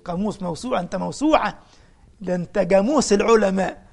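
"جاموس" said with a hard g, loud and amused; mock praise, loud. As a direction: retell the old man's slip with a loud punch. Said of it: "عايز يقول له يا قاموس". (تقليد ساخر بصوت عالٍ لكلمة "جاموس")

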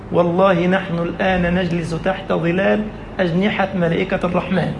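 normal talking voice

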